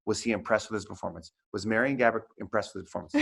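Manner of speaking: fast, quiet self-coaching under the breath; hurried quiet inner rehearsal before the question; nervous inner pep talk. Retold or imitated. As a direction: rush through it like a nervous reporter rehearsing in his head. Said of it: "And I, I'm like".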